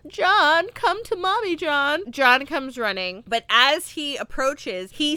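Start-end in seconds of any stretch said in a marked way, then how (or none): 0.1-2.0 high mock motherly call; Terminator as Sarah calling. Killer posing as mom calls to John, high mock call. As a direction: lure him in with a sweet, lightly faked mom voice. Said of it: "And she's like".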